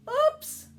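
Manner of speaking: sudden high, loud oops; a startled yelp as the basket tips. A narrator's reading. Narrator yelps as Ralph's kick topples the eggs, startled.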